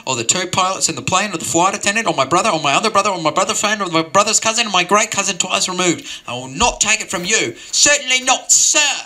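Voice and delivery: mock indignation peaking at sir; building indignant rant. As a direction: work yourself up to outrage. Escalating indignant rant in story.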